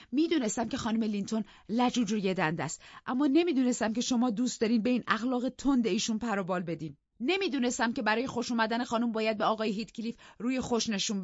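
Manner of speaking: a hint of a hurt maid talking back; offended servant. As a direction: stand up for yourself. Nelly defends herself.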